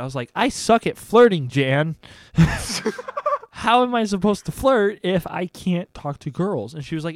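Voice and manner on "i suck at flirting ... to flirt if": louder, higher, hammed-up whine about being bad at flirting; retold cry of frustration to the therapist, raised and pitched up; mock exasperated complaint. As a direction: play up the despair for a laugh. Venting to his therapist.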